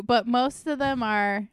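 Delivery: ordinary talk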